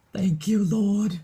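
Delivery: high, loud burst of relief; grateful exclamation. Retold or imitated. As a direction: exclaim it like a prayer answered. Recounting relief at meeting friend.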